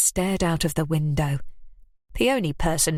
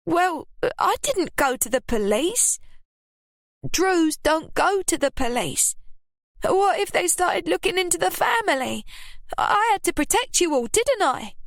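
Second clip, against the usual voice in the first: high, quick blustering woman; blustering Ruby; 11 semitones higher than usual. A narrator's reading. Ruby blustering to her daughter about the police. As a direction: bluster your excuse at Lily. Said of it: "Ruby blustered".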